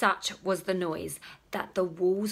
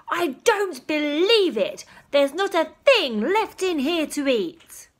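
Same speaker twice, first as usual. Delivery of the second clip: higher, louder outraged father; furious; 7 semitones higher, 5 dB louder than usual. Acted. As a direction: rant at the empty fridge. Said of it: "Father was furious".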